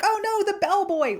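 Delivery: high mock cry of alarm. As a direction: squeal it like a caught guest.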